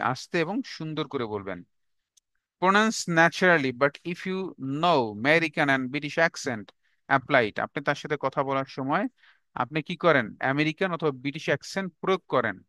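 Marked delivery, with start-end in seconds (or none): 2.6-7.6 slow dictation. Shown as a demonstration. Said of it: "dictating the point slowly".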